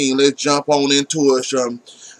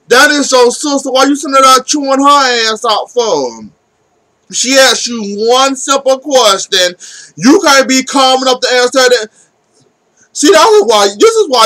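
exasperated scolding; 6 semitones higher than usual. Real, not acted. Reviewer scolding Dario for yelling at his sister, exasperated. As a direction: scold him like he should know better.

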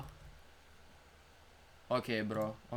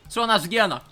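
normal talking voice

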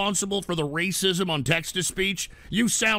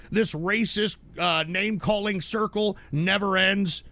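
usual speaking voice

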